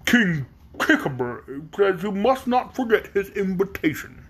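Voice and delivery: slow, drawn-out donkey voice; stretched-out donkey messenger; donkey servant. A narrator's reading. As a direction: deliver the king's message slowly, in an animal character voice.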